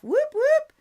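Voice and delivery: high, loud whoop whoop; excited cheer. Real, not acted. Host cheering a viewer's name in the live chat, excited. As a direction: cheer her on like a fan in the stands.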